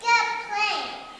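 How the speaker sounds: loud voice. Acted. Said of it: "said in a very loud voice".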